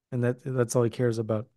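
ordinary talk

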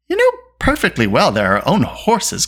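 affronted correction; indignant cry. A narrator's reading. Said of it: "cried Eilonwy".

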